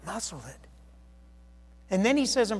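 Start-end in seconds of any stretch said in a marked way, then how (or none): 0.1-0.7 quiet. Shown as a demonstration. Preacher repeats the rebuke quietly.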